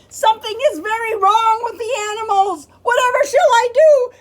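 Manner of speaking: high loud panicky cry; alarmed keeper. Acted. Keeper cries out in alarm.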